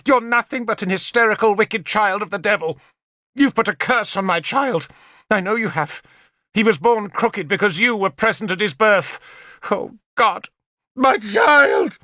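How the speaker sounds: high, fast, tearful tirade rising to a shout; screaming and weeping. A narrator's reading. Queen screaming curses at Nimue, weeping and high. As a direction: spit hatred through the tears. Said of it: "She was screaming and weeping".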